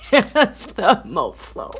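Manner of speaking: laughing as she says it; giggling through the words. Real, not acted. Laughing at own nickname.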